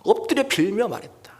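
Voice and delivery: very slow, weighty reading of the verse; solemn scripture reading. Acted. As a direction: read the verse slowly and reverently. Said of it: "성경에 이렇게 얘기해요".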